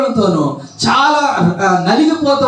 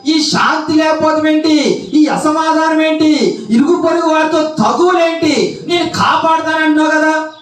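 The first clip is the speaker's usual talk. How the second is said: higher, fast run of anguished questions to God; pleading believer; 8 semitones higher than usual. Acted. Preacher voicing a believer crying out to God, higher and pressing. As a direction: pour out the complaints, pitch raised and pressing. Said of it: "నువ్వు అంటున్నావు".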